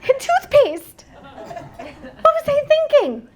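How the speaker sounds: high, hammed-up whine of self-doubt; mock bawling. Survivor listing her dislikes for laughs, mock wailing. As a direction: list complaints in a comically tearful, high voice. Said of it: "I start bawling".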